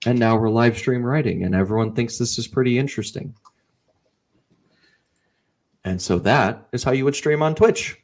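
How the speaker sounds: normal talking voice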